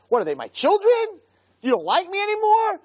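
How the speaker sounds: very high, aggrieved acted rant; exasperated protest. Moshe complaining to Hashem about his burden, exasperated. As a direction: fire off indignant questions in a high pitch.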